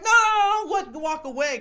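very high, very loud mocking shout; taunting yell. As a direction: yell after him as he walks away, daring him. Taunting roommate who slams door.

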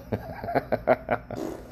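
airy wheezing laugh; breathless laugh. Real, not acted. Host cracking up at own fart joke, wheezy laugh. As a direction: wheeze out a silent giggle.

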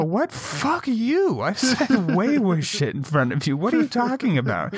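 high, outraged retold retort; indignant comeback. Retorting to coworker who called him out, indignant. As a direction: fire back at the accusation in mock outrage.

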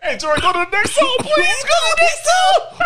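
shouting at Tori through laughter to skip ahead; laughing shout. Real, not acted. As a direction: holler for the song to change. Begging host to skip song.

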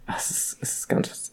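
hushed whisper. Real, not acted. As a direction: whisper so he can't hear. Whispering about boy outside.

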